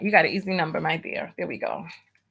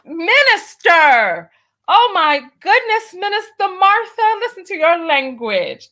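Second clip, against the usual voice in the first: mock scandalized; 7 semitones higher, 3 dB louder than usual. Host mock-scolds Minister Martha for cussing, hammed-up shock. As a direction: play the shocked church lady clutching her pearls.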